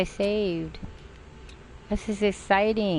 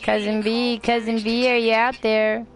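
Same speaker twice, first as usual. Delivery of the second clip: higher, raised call across the map; hollering for someone far off; 5 semitones higher than usual. Real, not acted. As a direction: call out for your cousin. Calling out to a teammate.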